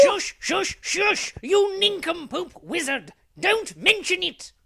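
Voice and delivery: high-pitched, agitated shushing; urgent scolding hush. Acted. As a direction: frantically silence a foolish companion. Hushing the wizard urgently.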